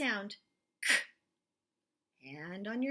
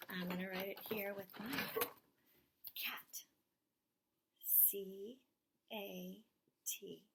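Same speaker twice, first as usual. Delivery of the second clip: softer, almost to herself, lower; soft whisper; 4 semitones lower, 10 dB quieter than usual. Acted. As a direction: murmur while writing the letters. Writing while whispering.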